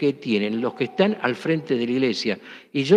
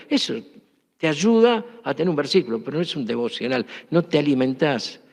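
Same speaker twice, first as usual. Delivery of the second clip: ordinary delivery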